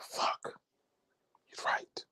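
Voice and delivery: whispered, beaten admission; defeated whisper. Acted. Conceding to the neighbor who out-argued him, whispered defeat. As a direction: breathe it like you just lost.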